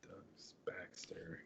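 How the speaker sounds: whispered name to self. Real, not acted. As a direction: read it under your breath. Reading name under breath.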